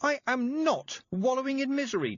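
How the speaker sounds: high indignant shout; girl shouting at the old witch. A narrator's reading. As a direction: throw the denial back at her. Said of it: "Tiffany shouted".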